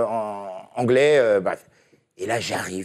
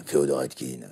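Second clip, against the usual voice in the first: very deep, softer imitation of a voice actor; Feodor Atkine; 13 semitones lower, 6 dB quieter than usual. Shown as a demonstration. Guest imitates a famous dubbing actor for the hosts, deep-voiced impersonation. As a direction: drop right down into his low register. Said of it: "on le reconnaît".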